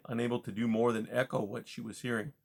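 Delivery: plain reading voice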